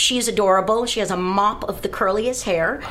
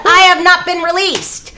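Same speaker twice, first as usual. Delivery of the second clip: louder and higher, affronted; indignant protest; 10 semitones higher, 8 dB louder than usual. Retold or imitated. Teen [name] refusing her father, loud and indignant. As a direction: refuse to leave like an offended young pro. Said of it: "I said".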